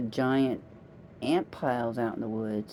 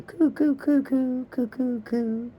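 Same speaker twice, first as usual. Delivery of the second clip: dove cooing; 11 semitones higher, slower than usual. Retold or imitated. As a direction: coo like a pair of doves, high and gentle. Imitating doves cooing.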